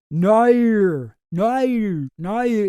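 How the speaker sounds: "Nair" repeated with a broad Australian vowel, falling each time; practising Aussie vowels. Shown as a demonstration. Podcaster practises the Australian vowel in "Nair" after a co-host's tip. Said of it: "Just say all the vowels".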